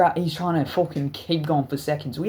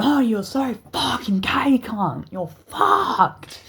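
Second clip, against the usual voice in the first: raised, drawn-out retold taunt; mock taunting outburst; 8 semitones higher, 3 dB louder, much slower than usual. Kid talking shit to another kid, taunting. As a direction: imitate a trash-talking kid, pitched up. Kid trash-talks the other.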